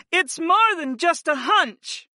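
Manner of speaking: high, slow insistent woman's voice. A narrator's reading. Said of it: "Sarah insisted".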